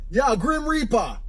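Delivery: put-on high, drawn-out Jamaican accent. Taxi driver introducing himself, Jamaican accent. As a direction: stretch it slowly in a high Jamaican voice. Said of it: "He had that Jamaican accent".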